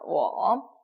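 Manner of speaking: 워 low and dipping; third tone. Shown as a demonstration. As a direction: dip 워 down, then let it rise. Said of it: "삼 성이죠".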